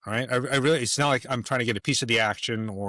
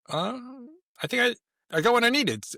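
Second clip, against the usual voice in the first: high, hesitant, amused reply; amused, sheepish; 12 semitones higher than usual. Retold or imitated. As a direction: play it like you already got the answer. Cuts conversation short, already satisfied.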